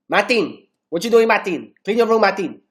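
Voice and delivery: mock telling-off, each Martin falling sharply; nagging parent. Imitates someone scolding him by name.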